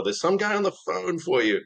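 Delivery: high, lightly voiced dad calling; dad at the door. Dad banging on bedroom door, lightly voiced high call. Dad wakes him at 3am.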